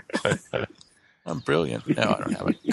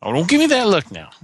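mock annoyance; 7 semitones higher, 6 dB louder, faster than usual. Character scolds companion.

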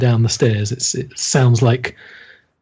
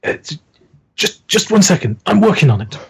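flustered Henri; 8 semitones higher, faster than usual. Acted. GM voices Henri working lock.

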